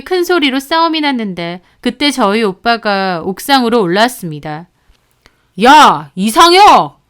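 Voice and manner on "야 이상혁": slow, loud angry summons; furious call. Acted. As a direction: call him out by name in rage. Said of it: "화가 머리끝까지 났죠". (화가 난 오빠가 남친을 부르는 고함)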